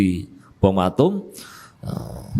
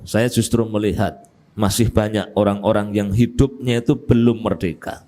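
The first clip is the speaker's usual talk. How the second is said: ordinary talk, nothing marked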